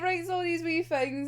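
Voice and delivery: ordinary delivery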